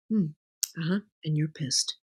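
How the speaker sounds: low, very soft murmur; soft mirroring. Retold or imitated. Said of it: "If he says".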